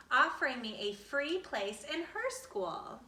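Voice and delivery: usual speaking voice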